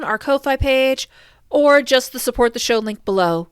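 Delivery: loud, steady outro announcement; podcast outro read. Acted. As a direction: announce the plugs to listeners.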